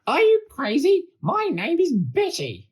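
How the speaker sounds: very high, loud indignant outburst; furious monster. A narrator's reading. Monster Betty corrects George's mistake, furious high shout. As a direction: shriek it in outrage at full pitch. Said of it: "stood up with a furious gaze".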